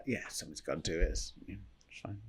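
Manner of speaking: low dry throwaway, fading to a whisper; mock-suffering aside, trailing off; ironic mutter. Real, not acted. Ironic aside about Malta.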